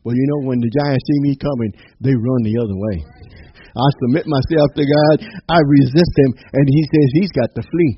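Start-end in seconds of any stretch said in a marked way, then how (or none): 3.7-7.9 louder, rising preacher's declaration; preaching. Acted. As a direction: proclaim it to the congregation.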